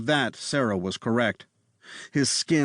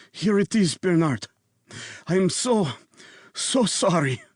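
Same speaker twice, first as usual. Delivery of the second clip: put-on Eastern European accent; 7 semitones higher than usual. A narrator's reading. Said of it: "Jolene detected an Eastern European accent".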